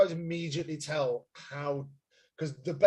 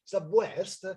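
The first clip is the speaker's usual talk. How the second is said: put-on generic European accent; 4 semitones higher than usual. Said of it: "Generic, generic, generic Europe".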